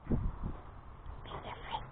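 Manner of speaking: slow, breathless whisper of the name. Real, not acted.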